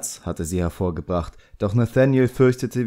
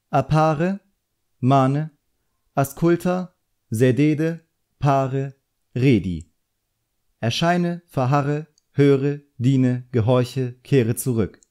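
eager, raised-pitch recital of spell words; a boy showing off the six summoning words, pitched higher; reciting incantation; 5 semitones higher, slower than usual. A narrator's reading. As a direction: rattle off the formula like a proud pupil.

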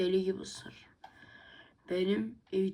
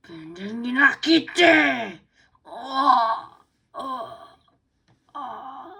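loud, slow hammed-up wail; loud hammed-up cry; mock wail; 4 semitones higher, 9 dB louder, much slower than usual. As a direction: wail it like a beaten dog. Dog wails after fight.

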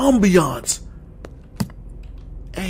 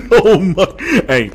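ordinary talk, nothing marked

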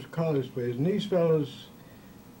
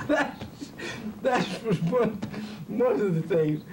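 high-pitched, amused talk through laughter; words broken up by real laughter; laughing; 6 semitones higher than usual. Real, not acted. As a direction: try to carry on while laughing at your own story. Laughing at his own story.

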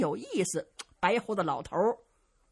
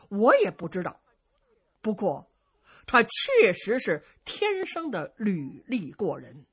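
usual speaking voice

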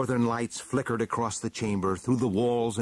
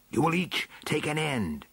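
put-on old dwarf elder; 6 semitones higher than usual. A narrator's reading. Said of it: "said the chief elder".

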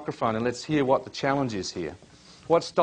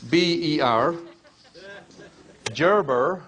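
a playful American accent, higher and louder; 5 semitones higher, 3 dB louder, much slower than usual. Shown as a demonstration. Coach finishing the author's name for the audience in a mock American accent. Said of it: "I'm trying my best American accent".

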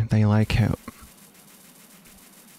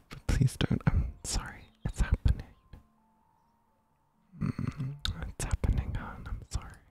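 hushed apologetic whisper; barely-there whisper, close and soft; ASMR whisper; 3 semitones higher, 8 dB quieter than usual. Shown as a demonstration. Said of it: "I'm doing an A S M R thing".

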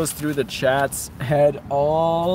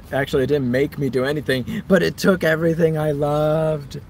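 usual speaking voice

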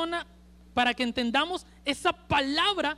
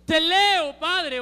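"Te leo, Padre" gritado más fuerte y más agudo; grito imaginado; 4 semitones higher, 7 dB louder, much slower than usual. Pretend. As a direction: clamando hacia el Padre con fuerza. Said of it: "Yo me imagino a Jesús en la cruz gritando". (Jesús gritando al Padre desde la cruz, fuerte)

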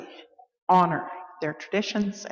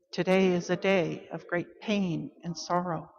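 quiet, restrained lament; solemn sorrow; 4 dB quieter than usual. Acted. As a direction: let the grief subdue your voice. Call to worship, sorrow against joy.

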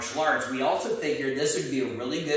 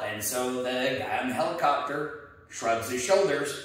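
normal talking voice

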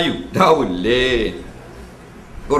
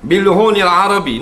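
Quranic recitation. Shown as a demonstration. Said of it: "Hendaklah kamu hias akan suara-suara kamu".

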